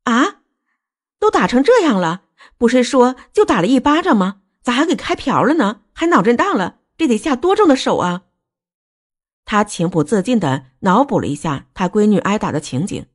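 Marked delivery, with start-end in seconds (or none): 0.1-8.2 high-pitched, shocked questioning; appalled disbelief. Acted. Parent hears of daughter's serious injury, appalled. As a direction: fire off upset questions, voice raised in pitch. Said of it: "韩明玉一下子受不了了".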